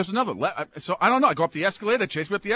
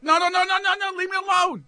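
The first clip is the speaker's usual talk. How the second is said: mock panicked refusal; 12 semitones higher than usual. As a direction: shout them away in mock panic. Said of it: "I'm like".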